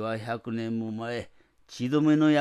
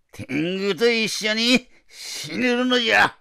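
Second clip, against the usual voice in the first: higher, louder roaring command; 8 semitones higher, 6 dB louder than usual. Acted. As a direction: bellow it with menace. Tengu demands she die.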